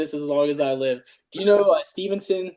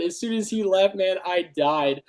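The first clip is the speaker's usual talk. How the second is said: ordinary delivery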